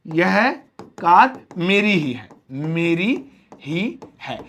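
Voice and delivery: "मेरी ही है" drawn out slowly, stressing ही; slow emphatic ही. Shown as a demonstration. Teacher slowly stressing ownership for students. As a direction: spell out that it really is mine, slowly. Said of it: "for example".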